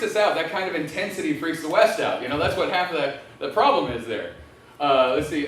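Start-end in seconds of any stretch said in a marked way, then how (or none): none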